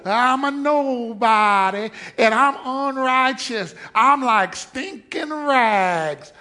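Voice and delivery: slow, mopey mock lament; lightly voiced self-pitying believer.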